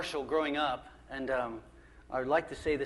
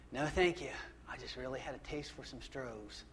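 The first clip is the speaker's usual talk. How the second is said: 1 weak whisper; 3 semitones lower, 8 dB quieter than usual. Retold or imitated. Thirsty man refuses water.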